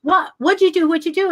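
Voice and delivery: excited pestering. Retold or imitated. Retold baffled back-and-forth at party.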